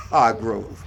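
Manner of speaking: "Hargrove" said "Argrove" with no H; silent H demo. Shown as a demonstration. Teacher repeats his name for the student, H silent. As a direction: say "Hargrove" without the H. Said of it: "You don't pronounce the ha".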